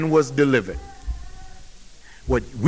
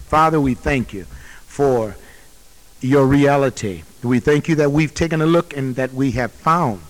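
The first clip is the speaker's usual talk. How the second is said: lower, hushed prayer voice; closing prayer; 7 semitones lower than usual. Acted. Closes the sermon in prayer.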